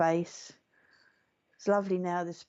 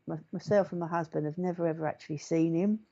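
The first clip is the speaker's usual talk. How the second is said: ordinary delivery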